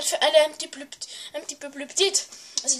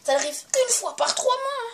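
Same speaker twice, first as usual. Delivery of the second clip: emphatic; 10 semitones higher, 3 dB louder than usual. Real, not acted. Emphatic exaggerated complaint.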